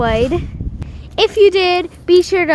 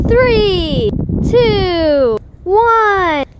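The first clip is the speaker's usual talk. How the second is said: excited countdown; 6 semitones higher, much slower than usual. Real, not acted. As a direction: count it down like a hype host. Countdown called to viewers.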